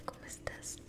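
usual speaking voice

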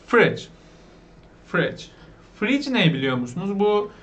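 usual speaking voice

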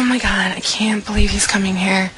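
hushed excited whisper; breathless secret whisper; nervous whisper. Acted. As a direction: whisper it so no one hears. Alone, nervous about date arriving.

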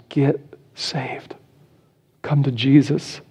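an urgent whispered plea. Acted. Pastor pleading with little kids, in a whisper. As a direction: breathe get saved as a whisper.

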